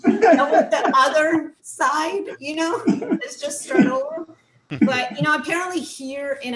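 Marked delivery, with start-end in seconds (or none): 0.0-4.3 high, laughing, hammed-up apology voice; over-the-top sorry routine, giggling through it. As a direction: gush apologies like the guilty other half.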